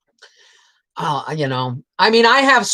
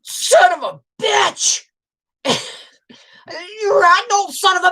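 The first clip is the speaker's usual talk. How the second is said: high, slow, put-on voice, half laughing; playful insult in a high cartoonish voice; mock outburst at dad; 5 dB louder, slower than usual. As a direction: blurt it out in a teasing high voice. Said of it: "And I went".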